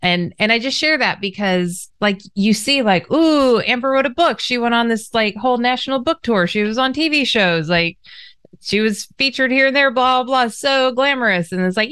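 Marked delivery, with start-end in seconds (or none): 3.1-11.5 mock envious gushing. Imitating others' envious comments.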